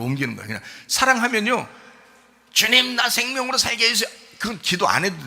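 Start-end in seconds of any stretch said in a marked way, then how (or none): 2.5-4.1 raised-pitch pleading imitation; mock tearful prayer. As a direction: mimic someone begging God through tears. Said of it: "눈물도 안 흘려도 돼". (눈물로 간구하는 기도 흉내)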